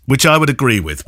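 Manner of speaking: ordinary delivery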